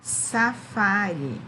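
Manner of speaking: Safari drawn out slowly, sa-FA-ri; stress on FA. Shown as a demonstration. Teacher sounding out the word for pupils, slow sa-FA-ri. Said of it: "Qual é a sílaba tônica?".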